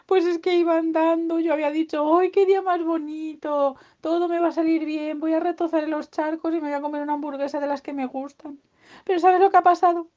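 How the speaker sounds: high-pitched little creature voice; whiny, childlike storytelling; plaintive piglet. Acted. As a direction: pour out the woes in a high voice. Piglet recounts its spoiled day.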